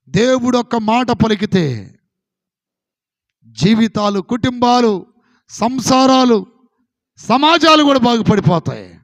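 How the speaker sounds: loud, rising preacher's cry building to a peak; preaching at full force. Acted. Pastor rousing the congregation, loud fervent preaching. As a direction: hammer each word home to the congregation.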